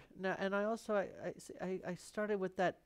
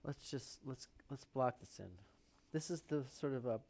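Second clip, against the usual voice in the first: usual speaking voice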